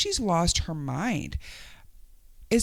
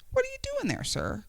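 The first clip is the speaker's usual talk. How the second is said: incredulous; 6 dB quieter than usual. Real, not acted.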